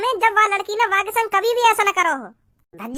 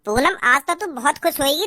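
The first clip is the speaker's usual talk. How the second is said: normal talking voice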